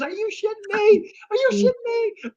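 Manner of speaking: squeaky-high thrilled incredulity; hammed-up starstruck amazement; retold disbelief. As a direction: imitate an overwhelmed fan, voice way up high. Imitating the fan's excited disbelief.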